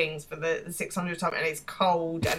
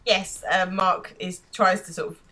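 normal talking voice

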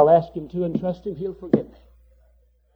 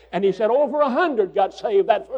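ordinary delivery

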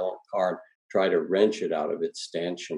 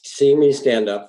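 ordinary delivery, nothing marked

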